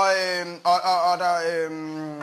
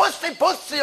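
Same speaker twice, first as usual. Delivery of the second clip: calling out; 7 semitones higher, slower than usual. Retold or imitated. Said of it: "de står der og råber".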